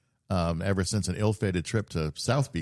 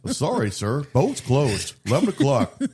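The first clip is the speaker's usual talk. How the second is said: Goofy the staffer; 6 semitones higher, 5 dB louder than usual. Retold or imitated. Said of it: "Goofy there in his Goofy outfit's like".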